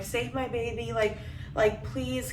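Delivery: normal talking voice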